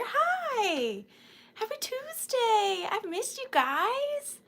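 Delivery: slow high cooing voice; high sing-song greeting. Acted. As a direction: coo a welcome to the viewers. Greeting again in put-on voice.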